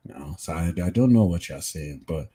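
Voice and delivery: usual speaking voice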